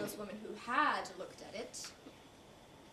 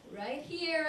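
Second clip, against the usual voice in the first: higher call, drawn up on HERE; calling attention; 5 semitones higher, 4 dB louder than usual. Acted. Woman waving at Bob to be seen, calling out higher. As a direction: call out to be noticed.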